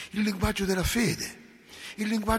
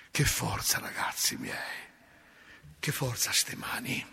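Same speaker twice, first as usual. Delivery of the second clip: awed whisper; 5 semitones lower, 5 dB quieter than usual. Real, not acted. Awed aside on blessing hands.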